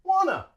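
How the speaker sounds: "wanna" with no T, ending in schwa; dropped T. Shown as a demonstration. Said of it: "we drop the T".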